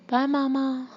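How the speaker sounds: put-on high children's farewell call; mild yell in a child's pitch. A narrator's reading. Children yell goodbye to Mama from the car. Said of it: "the children yelled".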